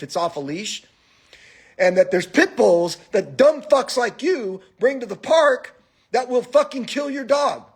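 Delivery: high-pitched scolding rant, re-enacted; re-enacting an indignant dressing-down; retold angry tirade. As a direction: tell him off, pitch climbing. Retells own angry tirade.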